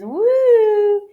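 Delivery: high, loud teasing whoop; playful woo. A narrator's reading. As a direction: give a drawn-out, high "woo" over love letters. Teasing about love letters in story.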